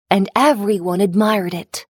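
ordinary delivery

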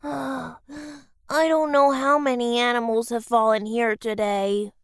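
low, tired sighing voice; weary sigh. Acted. Fox sighing to herself by the frozen river, weary. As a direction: sighs out the words, defeated.

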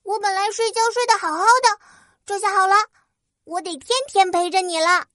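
high, fast grumbling fairy; huffy little fairy complaining; tiny tinkling fairy voice. Acted. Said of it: "越说越生气".

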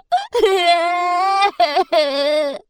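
tearful alien sob; sobbing. Acted. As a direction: break into a sob. Stranded alien weeps.

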